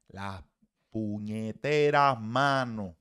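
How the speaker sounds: Las puñeteras manos, slow and punched loud; stress on manos. Real, not acted. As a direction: stretch out puñeteras and hit manos hard. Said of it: "emphatic complaint about hands". (recalcar despacio y fuerte "puñeteras manos")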